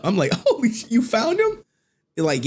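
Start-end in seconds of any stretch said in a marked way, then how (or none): 0.5-1.6 much higher, thrilled disbelief; lit up. Retold or imitated. Host retelling a troll's message, sudden excitement. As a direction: jump up in pitch like great news just landed. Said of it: "I lit up".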